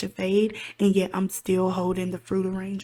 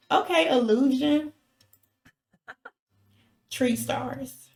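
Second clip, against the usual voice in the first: higher and louder, excited; 5 semitones higher, 5 dB louder, slower than usual. Real, not acted. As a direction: blurt it out with glee. Excited reference to the film.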